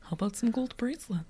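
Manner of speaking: softly voiced Napoleon Dynamite character; Kip. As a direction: quote the film in a light nerdy imitation. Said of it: "kind of like a Kip from Napoleon Dynamite voice".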